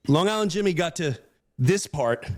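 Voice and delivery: normal talking voice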